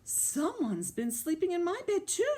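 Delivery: high storybook mama bear; high, put-out mother bear. A narrator's reading. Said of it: "said Mama Bear".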